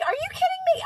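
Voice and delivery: squeaky high disbelieving complaint; mock exasperation. Scolding her cat for the wake-up, high mock outrage.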